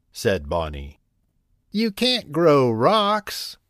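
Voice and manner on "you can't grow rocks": much higher, slow, hen-like teasing voice with a light laugh. A narrator's reading. Hens teasing Bonnie about growing rocks, high slow mock laugh. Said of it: "laughed the hens".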